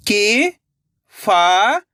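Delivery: शिकायत stretched out slowly, sound by sound, rising at the end; slow syllable by syllable. Shown as a demonstration. Reading teacher sounding out a word for children, slowly syllable by syllable. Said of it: "मेरे तरीके पे गौर करना है".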